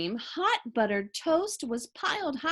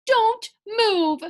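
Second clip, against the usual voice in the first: higher, louder, sharp command; urgent shout; 10 semitones higher, 8 dB louder than usual. A narrator's reading. Husband ordering his family not to move, urgent shout. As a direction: freeze them with a shout. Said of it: "shouted Mister Watson".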